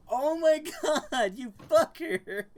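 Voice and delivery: half-shouted laughing insult; laughing at a friend's prank, mock shout; laughing mock outburst. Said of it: "He was just like".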